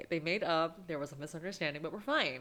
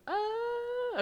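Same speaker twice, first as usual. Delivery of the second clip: emotional cry. Real, not acted. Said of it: "I'm emotional".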